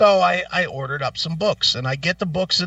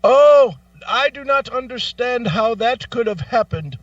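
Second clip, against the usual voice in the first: high, loud start, stiff formal promise; mock formal agent; 7 semitones higher, 3 dB louder than usual. Agent promising caller a fix, high formal mock voice. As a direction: sound officially apologetic.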